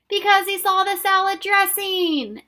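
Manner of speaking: loud, high group shout; yelling together. Acted. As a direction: yell the answer back. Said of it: "everyone yelled back".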